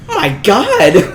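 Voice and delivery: shocked. Retold or imitated. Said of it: "And I was like".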